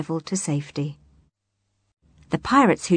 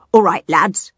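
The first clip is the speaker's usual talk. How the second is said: barked; 8 dB louder than usual. Acted. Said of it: "he barked".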